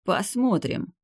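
high, sly menacing smirk; evil chuckle. A narrator's reading. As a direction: smirk it with malice. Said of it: "злобно усмехнулся я".

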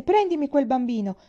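high, loud and insistent; a little girl begging to have it; eager child's demand. A narrator's reading. (richiesta infantile, acuta e forte)

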